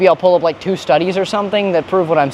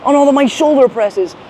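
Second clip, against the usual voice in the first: much higher, hammed-up despair; mock regret; 10 semitones higher than usual. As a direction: ham up a fake lament. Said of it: "I can look back and be like".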